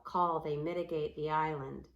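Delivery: ordinary delivery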